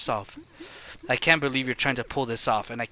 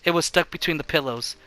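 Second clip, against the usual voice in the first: normal talking voice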